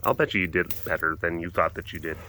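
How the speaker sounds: low, soft and even; low-key reassurance. Real, not acted. First take, before garbled repeats.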